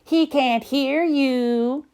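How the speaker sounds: lilting sisterly tease; singsong. Acted. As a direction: chant it like teasing little sisters. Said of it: "sang his sisters".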